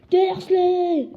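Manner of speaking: loud high yell of the name. A narrator's reading. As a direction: bellow the uncle's name. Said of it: "hurla-t-il".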